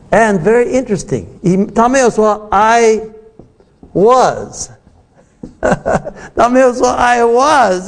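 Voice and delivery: ordinary talk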